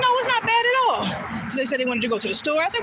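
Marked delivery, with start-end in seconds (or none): 0.0-1.0 reassuring. Retold or imitated. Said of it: "And I was like".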